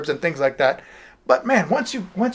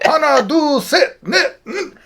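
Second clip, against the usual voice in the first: high, louder put-on counting voice; comic foreign count-in; 12 semitones higher, 3 dB louder, much slower than usual. Shown as a demonstration. Teacher varying the count-in for laughs, high comic foreign voice.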